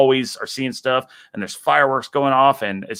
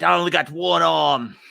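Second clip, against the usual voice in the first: put-on higher-pitched Australian accent; game-for-more Aussie croc hunter; Steve Irwin; 4 semitones higher than usual. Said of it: "He's like".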